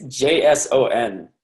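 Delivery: "J S O N" said as four separate letters; spelled out. Shown as a demonstration. Teacher correcting Jason for the class, spelled out. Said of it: "it's not Jason".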